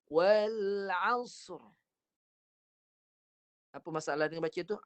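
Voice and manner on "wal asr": wal asr with sad bounced and merged into ra; wrong reading. Shown as a demonstration. Teacher demonstrating the second mistake, sad bounced into ra. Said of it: "Kesalahan kedua".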